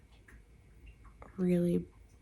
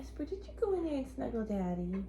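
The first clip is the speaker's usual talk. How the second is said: sweet pet talk; 5 semitones higher, 6 dB quieter than usual. Real, not acted.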